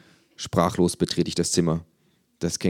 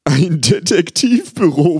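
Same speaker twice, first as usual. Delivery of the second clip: much higher, louder, slow amused doctor; incredulous psychiatrist; 12 semitones higher, 10 dB louder, slower than usual. Acted. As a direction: raise the question in mock disbelief. Psychiatrist voiced in story.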